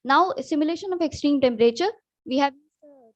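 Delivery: ordinary delivery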